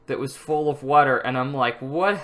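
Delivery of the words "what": rising panicked squeal; mock horror, voice jumping high; playful retold panic; shocked. Panicked self-quote after accident.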